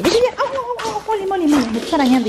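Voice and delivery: pained yelps. Real, not acted. Dog scratching her legs.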